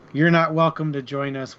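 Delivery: normal talking voice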